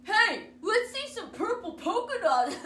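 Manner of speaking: put-on loud, high kid's taunt; yelling kid. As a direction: yell it across the class. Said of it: "One yelled".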